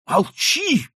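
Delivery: high, loud frightened cry; horrified cry. A narrator's reading. Marquise silencing her daughter in horror. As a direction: cut her off in terror. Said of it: "воскликнула маркиза в ужасе".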